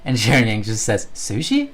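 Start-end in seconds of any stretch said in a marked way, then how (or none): 1.2-1.7 "Sushi?" asked with a big high rise; questioning tone. Retold or imitated. Reading a chat message aloud, "Sushi?" with a high questioning rise. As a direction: read "Sushi?" as a puzzled question. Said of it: "Question mark".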